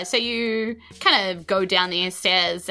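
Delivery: ordinary delivery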